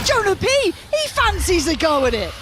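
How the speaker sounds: excited. Real, not acted. Caster hypes a wild round.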